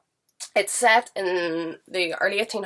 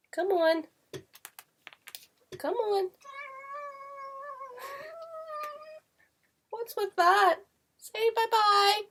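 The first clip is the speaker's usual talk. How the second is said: high sing-song coaxing voice; pet talk; 5 dB quieter, slower than usual. Real, not acted. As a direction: call a pet over in a high little voice. Coaxing the cat out of shot.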